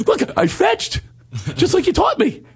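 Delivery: very high puppy voice; mock eager puppy. Mocking himself as a dog seeking approval, very high. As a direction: chirp it like a dog proud of fetching.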